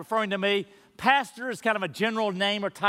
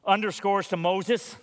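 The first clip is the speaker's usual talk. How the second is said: ordinary talk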